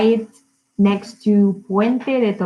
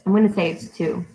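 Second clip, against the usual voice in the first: ordinary delivery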